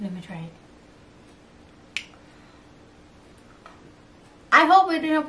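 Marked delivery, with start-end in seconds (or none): 0.0-2.0 whispering. Real, not acted. ASMR jelly tasting.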